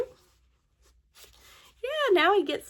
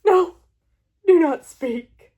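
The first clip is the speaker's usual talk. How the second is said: louder, mock-tragic goodbye; melodramatic farewell; 5 dB louder than usual. Gerald tells Piggy not to speak, loud mock-tragic farewell.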